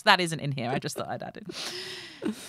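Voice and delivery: usual speaking voice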